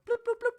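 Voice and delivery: very high, quick imitated pop-up noises; cartoonish mouth sound effect; popping bloops. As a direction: bubble out little bloop sounds.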